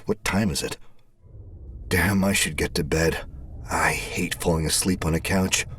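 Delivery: usual speaking voice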